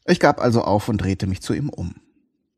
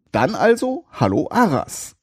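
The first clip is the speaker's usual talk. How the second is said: im selben Tonfall; 7 semitones higher than usual. Acted. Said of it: "antwortete ich im selben Tonfall".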